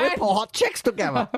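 high put-on voice; imitating doctor. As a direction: agree cheerfully like the odd doctor. Doctor voice continued.

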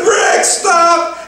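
high, loud shout to stop him; urgent shout. Retold or imitated. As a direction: yell him back before he runs off. Calling deckhand back urgently.

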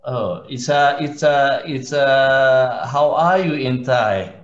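ordinary delivery

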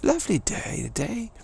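high-pitched British chap, sing-song; cheery British accent. Acted. Posh cheerful British banter act.

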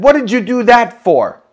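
high, loud indignant question; retold startled protest. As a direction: re-enact a loud, high-pitched objection. Startled protest at friend grabbing phone.

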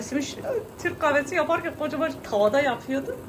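ordinary talk

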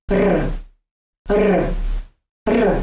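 "R" said as a short flicked trill; Russian R drill; rolled R. Shown as a demonstration. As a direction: flick the tongue tip for each "R". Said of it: "Try lightly flicking the tip".

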